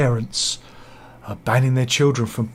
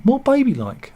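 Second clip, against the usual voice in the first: put-on baby voice; 11 semitones higher, 4 dB louder than usual. Shown as a demonstration. Describing baby-like romantic laughter.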